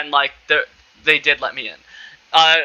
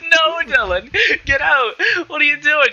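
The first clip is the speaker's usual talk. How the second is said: retold yell; 8 semitones higher than usual. Uncles throwing him out.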